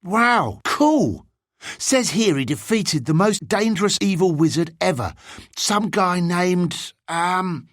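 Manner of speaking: put-on high-pitched, awed boy, slowing as he searches for the name; impressed bigger boy. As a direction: marvel in a high boy's voice. Bigger boy reads from booklet, impressed.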